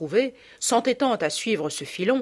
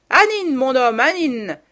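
loud, slow solemn call; energetic, biblical; 6 semitones higher, 8 dB louder than usual. A narrator's reading. Mother summons her husband to dance, loud solemn call. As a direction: proclaim it like a prophetess.

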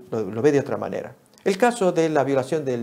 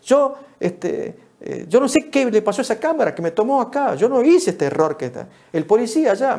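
higher-pitched mock protest; protesting driver; 7 semitones higher, 3 dB louder than usual. Traffic school pupil giving their case, mock protesting. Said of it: "cada cual poniendo su caso".

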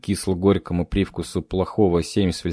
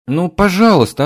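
put-on high whiny girl's pleading; whining girl; 10 semitones higher, 7 dB louder than usual. A narrator's reading. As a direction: whine like a scolded young maid. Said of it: "заныла горничная".

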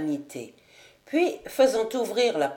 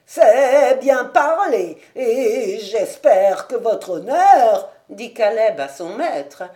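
put-on old servant pleading; 7 dB louder than usual. Caleb pleads with his master, loud quavering old servant. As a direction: plead like a fussing old retainer.

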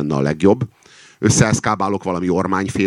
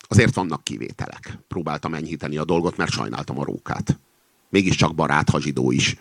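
ordinary delivery, nothing marked

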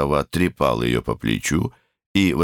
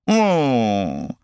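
высокое раздражённое «м-м-м»; мычи с досадой, коротко; раздражённое мычание; 10 semitones higher than usual. A narrator's reading. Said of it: "раздражённо ответила Коколи". (раздражённое мычание сквозь сон)

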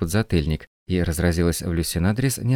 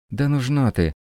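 high-pitched dismissive exclamation; mild cry out; 7 semitones higher than usual. A narrator's reading. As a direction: throw it out as a restrained yell. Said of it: "хором выкрикнули супруги". (негромкий выкрик)

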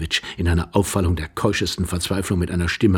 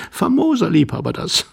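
mocking laughter; 11 semitones higher, 3 dB louder than usual. Laughs at old lover.

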